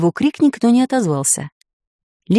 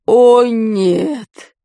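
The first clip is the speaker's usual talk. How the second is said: groaning; 4 dB louder than usual. A narrator's reading. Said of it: "простонал Дурнев".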